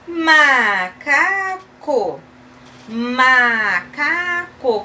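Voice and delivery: very slow, each syllable stretched. Shown as a demonstration. Teacher sounding out a picture word for children, syllable by syllable. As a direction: draw out every syllable clearly.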